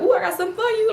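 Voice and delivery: high, sing-song gossip; gleeful gossip. Retold or imitated. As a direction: tease with juicy news. Mocking gleeful snitch.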